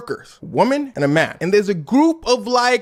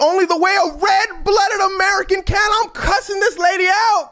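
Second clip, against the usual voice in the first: usual speaking voice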